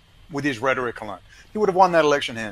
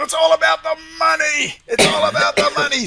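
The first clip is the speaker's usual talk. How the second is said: high, loud frantic yell; screaming at the TV; 13 semitones higher, 6 dB louder than usual. Retold or imitated. Sean Penn's character yelling at the television, high frantic scream. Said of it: "screaming at the television".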